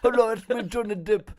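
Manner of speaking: mock South African Johnny Depp; hammed-up South African film star pitch; South African accent sales pitch. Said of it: "it's my Johnny Depp accent".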